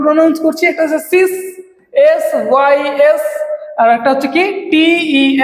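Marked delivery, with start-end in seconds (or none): none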